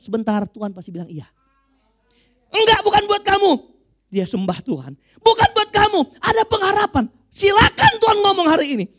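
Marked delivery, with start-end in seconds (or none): 2.5-3.5 high, loud refusal; emphatic rejection. Acted. Refusing the woman who begs, emphatic rejection. 5.2-6.0 high, loud repeated refusal; emphatic rejection. Acted. Refusing the woman who worships, emphatic rejection. 6.2-8.9 high, loud insistence; defiant hope. Acted. Rejected woman holding on to hope, defiant hope.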